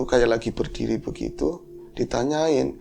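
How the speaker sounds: plain reading voice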